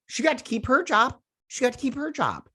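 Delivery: indignant disbelief. Real, not acted. Vlogger venting to viewers about the verdict, indignant and pitched high. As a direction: spit it out like you can't believe it. Indignant at Amber Heard's job.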